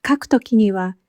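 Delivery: normal talking voice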